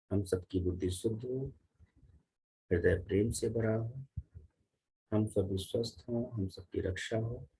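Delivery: soft, low-key prayer for everyone; spoken prayer. Real, not acted. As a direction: pray quietly in short, separate petitions.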